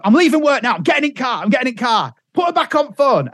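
high, urgent acted phone voice; mock frantic insistence; panicked husband. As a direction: imitate a man rushing off in a flap. Husband panics, rushing to the car.

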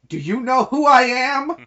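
loud, high mock outrage; indignant outburst. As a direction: belt it out as an affronted big shot. Famous indignant line acted out.

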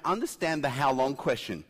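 ordinary talk, nothing marked

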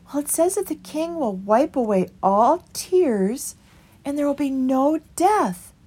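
meek small creature pleading for the way; Christopher. A narrator's reading. Christopher explains.

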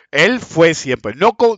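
ordinary talk, nothing marked

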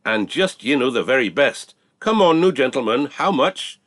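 high, loud auctioneer patter; auctioneer's shout. Acted. Auctioneer shouting to buyers to bid on the bull. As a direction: hawk the bull to the crowd. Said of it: "he shouted".

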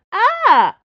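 loud, high answering shriek; startled scream. A narrator's reading. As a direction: shriek right back in alarm. Planchet startled, screams back.

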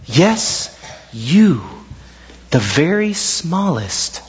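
low, soft tender angel voice; soft gentle voice. Acted. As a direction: call the little one gently, almost whispered. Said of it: "a soft, gentle voice said to it".

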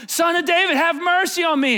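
high, loud shouted plea; crying out. Acted. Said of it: "he cried out all the more".

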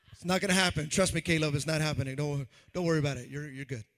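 loud laughing reassurance; loud, laughing teasing. Real, not acted. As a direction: laugh loudly through the joke. Joking after his mock singing.

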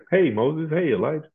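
high, playful mock greeting; Jesus. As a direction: call out hello in a high comic voice. Imagined Jesus greeting Moses and Elijah.

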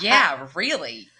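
loud, drawn-out defiant retort; defiant comeback. Retold or imitated. Teenage driver answering a disapproving woman, loudly defiant. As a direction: throw it back slowly and loudly, unapologetic. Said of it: "now I'm like".

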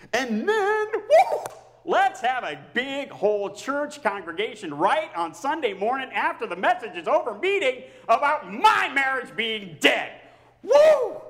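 high, loud sarcastic whoop; mock excitement. Real, not acted. Pastor mocking his own church meeting with a loud sarcastic whoop. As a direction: cheer it up with biting sarcasm.

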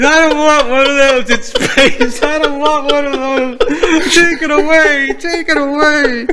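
high, slow whiny mimic; mocking imitation. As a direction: mimic his horrified reaction. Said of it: "He's like".

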